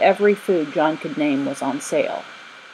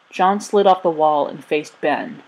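plain reading voice

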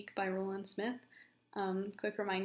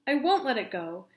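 louder, defiant refusal; defiant outburst; 5 semitones higher, 8 dB louder than usual. Acted. Peak refusing Josh to his face, loud defiant cry. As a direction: throw the refusal back, loud.

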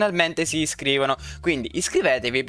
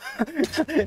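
panicked laugh; 11 semitones higher than usual. Real, not acted. Laughs during chase.

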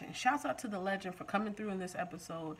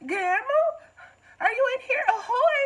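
high, loud sing-song call of "Grandma"; hammed-up high calling voice of a nosy neighbor; mock calling out; 9 dB louder than usual. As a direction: ham up a nosy lady calling into the boat. Mocking the neighbor lady calling out.

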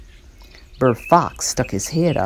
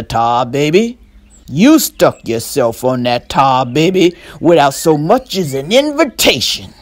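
louder, relishing taunt; drooling fox; 6 dB louder than usual. Acted. Burr Fox taunting the trapped rabbit, hungry and loud. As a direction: lick your lips over every word. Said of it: "the drool in Burr Fox's voice".

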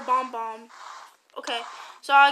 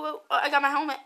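ordinary delivery

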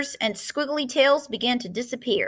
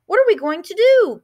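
high, loud worried cry; a mother crying out in alarm; distressed cry; 9 semitones higher, 5 dB louder than usual. A narrator's reading. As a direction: plead in alarm. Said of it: "cried Missus Cream".

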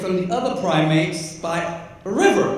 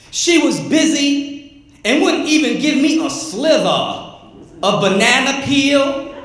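higher, rhythmic spoken-word delivery; poem recital; 7 semitones higher, 4 dB louder than usual. Acted. Poet performing Broke Bonobo to an audience, spoken-word recital. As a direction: perform it as a comic poem, lingering on lines.

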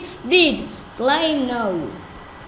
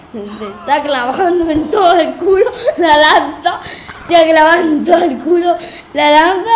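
laughing. Real, not acted. Laughing at the spear crash.

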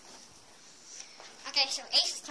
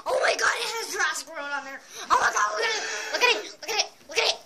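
higher, louder oh my God; thrilled by the TV; excited; 6 semitones higher, 5 dB louder, faster than usual. Real, not acted.

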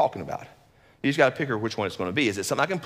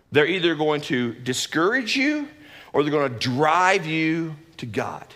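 ordinary delivery

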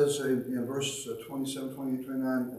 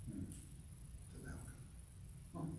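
whispered very slowly, much softer; hushed whisper; 3 semitones lower, 20 dB quieter, much slower than usual. Acted. Preacher repeating the point to the congregation in a slow whisper. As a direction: breathe it out slowly, letting it sink in.

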